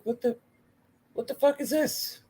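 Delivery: reliving a jaw-dropping TV moment; high-pitched what-the-hell reaction; stunned disbelief. Retold or imitated. Shocked reaction to smoke.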